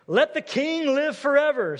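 butter up the king grandly; flattering the king. Retold or imitated. Nehemiah addressing the king, a lightly voiced courtly flourish. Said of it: "I said to the king".

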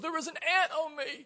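high-pitched upset child imitation; mad little daughter. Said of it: "the madder she got".

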